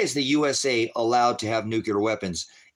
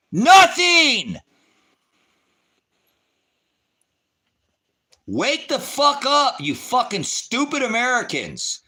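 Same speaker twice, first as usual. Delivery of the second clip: screaming at the top of his lungs, pitch way up; furious shout; 9 dB louder, slower than usual. Real, not acted. Ranting streamer screams at stupid Americans over bombing Iran, furious shout. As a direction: rage at the viewers, every word a yell.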